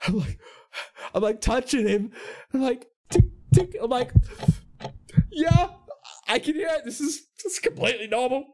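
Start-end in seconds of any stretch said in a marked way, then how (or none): 3.1-3.8 high, loud, abrupt ticks; imitated ticking. Clerk mimicking the ticking in a man's chest, high and loud. 5.3-8.4 high, strained agreement; uneasy false calm. Retold or imitated. Clerk with his head on a customer's chest, uneasily agreeing.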